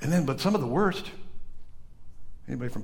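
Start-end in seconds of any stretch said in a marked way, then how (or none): none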